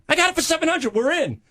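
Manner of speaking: put-on a teen telling his agent about the cheap deal, excited and high; excited retelling. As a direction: pitch it up like he's thrilled with the bargain.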